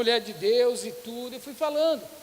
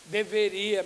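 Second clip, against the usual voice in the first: usual speaking voice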